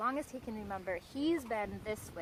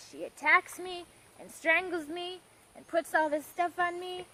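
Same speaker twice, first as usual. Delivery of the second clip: higher-pitched, mildly hammed-up complaint; aggrieved beast; 6 semitones higher, 4 dB louder than usual. Beast telling its side of the forest story, in a higher aggrieved voice. Beast retells its ordeal indignantly.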